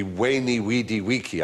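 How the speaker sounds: Veni, Vidi, Vici with every V said as W. Shown as a demonstration. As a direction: say Veni, Vidi, Vici as Weni, Widi, Wiki. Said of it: "pronounce the V as a W".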